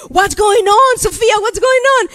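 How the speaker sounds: high, loud worried demand; urgent questioning. Retold or imitated. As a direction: replay a mother pressing her crying child. Mother urgently questions crying daughter.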